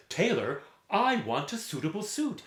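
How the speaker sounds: high, loud call of a demanding customer; gentleman customer calling. Acted. Customers call to the tailor for a suit, high and loud.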